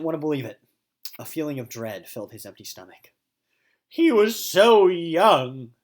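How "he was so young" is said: slow, loud, high mock lament; grief. Mister O mourning the dead sock puppet, hammed-up grief. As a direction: mourn it melodramatically. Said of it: "he thought".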